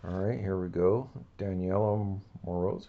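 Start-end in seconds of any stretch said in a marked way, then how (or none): none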